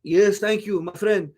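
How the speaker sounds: halting, muddled mock-Mexican accent; Canelo, mock Mexican accent. Streamer mocking humble Canelo's thanks after the fight, muddled Mexican accent. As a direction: put on a hammy foreigner's English, vaguely Mexican. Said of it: "where I get this accent".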